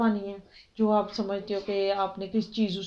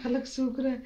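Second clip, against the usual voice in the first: mock thirsty plea; 3 semitones higher than usual. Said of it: "کہتے ہیں نا؟".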